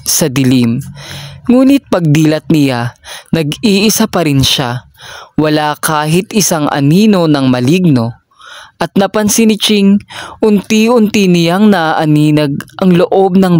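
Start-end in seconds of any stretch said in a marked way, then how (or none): none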